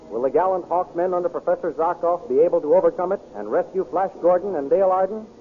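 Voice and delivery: normal talking voice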